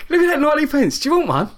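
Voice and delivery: 높은 톤으로 들떠서 "Look at that" 하는 흉내; 싼 페이스트리 자랑하듯 신나게; excited coworker. Retold or imitated. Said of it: "he all excited".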